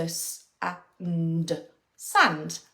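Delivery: "s", "a", "nd" slowly then blended "sand"; sounding out. Shown as a demonstration. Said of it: "sound out".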